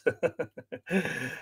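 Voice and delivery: soft, trailing chuckle; amused chuckle. Real, not acted. Host laughing at own breakfast joke, soft chuckle.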